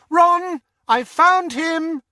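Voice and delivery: very high, loud shout. Acted. Hermione shouts down the stairs to Ron, very loud and high. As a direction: yell it down several floors. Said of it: "shouted over her shoulder".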